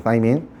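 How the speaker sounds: 사이민 with th and an "eye" vowel; original-language pronunciation. Shown as a demonstration. Teacher giving students the original pronunciation, th sound. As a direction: say 사이민 softly as "thigh-meen". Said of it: "원어 발음 이렇습니다".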